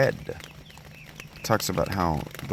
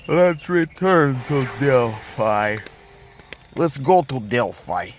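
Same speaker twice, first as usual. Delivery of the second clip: slow, loud fake Russian accent; a hammy, terrible Russian accent; over-the-top fake Russian accent; awful Russian accent; 5 semitones higher, 5 dB louder, slower than usual. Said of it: "with our Russian accent that is awful".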